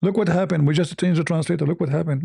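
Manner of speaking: ordinary talk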